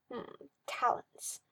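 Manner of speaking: slow, breathy, suggestive; whispered innuendo; leering whisper. Acted. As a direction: savour the word with a hushed leer. Magister leering pause.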